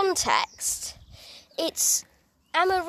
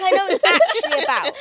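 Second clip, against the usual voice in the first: laughing; 3 semitones higher, 6 dB louder, faster than usual. Real, not acted.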